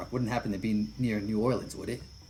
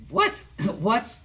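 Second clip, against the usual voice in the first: very high, loud startled what; real surprised squeak then laughter; genuine surprise; 13 semitones higher, 9 dB louder than usual. Real, not acted. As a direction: caught off guard, then laugh. Actor surprised by other's line.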